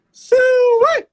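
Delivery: very high, loud hog holler; country hog call. Acted. Country boy punctuates his speech, piercing hog holler. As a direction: let out a piercing farmyard call.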